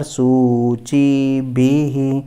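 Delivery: सूची भी ही chanted loud with long vowels and an echoed hee; loud, slow सूची भी ही on a level tone; visarga pronunciation. Shown as a demonstration. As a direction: hold each syllable of सूची भी ही. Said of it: "वैसे यहाँ पर".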